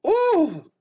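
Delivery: high, impressed ooh. Real, not acted. Streamer admiring a card, high impressed ooh. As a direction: coo at the shiny card.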